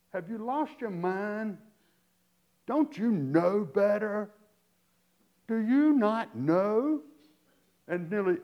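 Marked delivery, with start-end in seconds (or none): 0.1-7.0 slow, louder, incredulous scolding questions; biting sarcasm. Retold or imitated. Preacher voicing Paul scolding the Corinthian saints, slow biting sarcasm. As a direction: stretch each word like a stern scold. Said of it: "It's sarcasm".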